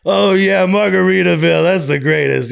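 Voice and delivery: high, loud imitation of a rapper's voice, lightly done; impersonating a tiny guy who talks like a rapper. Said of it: "like, a [name] type voice".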